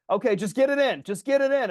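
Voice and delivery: a first, milder self-yell between points; yelling at myself. Retold or imitated. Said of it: "I'm, like, yelling to myself".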